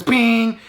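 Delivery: high-pitched ringing "bing"; imitated loud bang. Mimicking the trailer's jump-scare bang, high imitated noise. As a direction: mimic a sudden bang with one high ping. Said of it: "big old loud bang happens".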